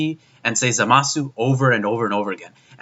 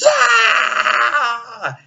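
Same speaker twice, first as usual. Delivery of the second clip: sudden high yelped cry; hammed-up anime death scream; mock death cry; 10 semitones higher than usual. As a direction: act out a cartoon villain dying with a shrill yelp. Imitating Zamasu's death cry.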